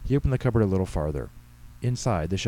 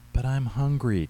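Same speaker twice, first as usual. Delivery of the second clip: put-on higher-pitched whiny little boy; whining; 5 semitones higher, slower than usual. A narrator's reading. Six-year-old begging his mother for cookies, whining. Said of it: "Tyler whined".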